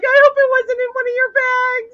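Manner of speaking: loud, high-pitched worried cry; alarmed exclamation. Real, not acted. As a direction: blurt out the worry at high volume. Exclaiming worry about the snake.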